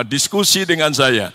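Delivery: ordinary talk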